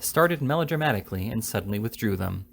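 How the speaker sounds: ordinary delivery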